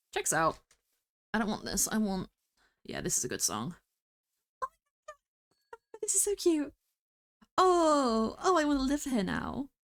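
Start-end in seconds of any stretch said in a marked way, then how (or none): 4.6-9.7 excited squeal. Real, not acted. Gushing over a cute biome.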